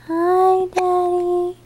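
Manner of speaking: put-on high little baby voice for Daddy; baby's voice. Mom voices baby greeting Daddy, high baby voice. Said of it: "Say hi, Daddy".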